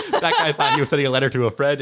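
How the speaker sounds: laughing while talking; chuckling through it. Real, not acted. Laughing at his own story.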